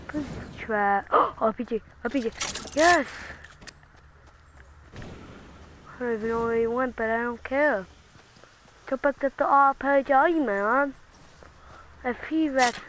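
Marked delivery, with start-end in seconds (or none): none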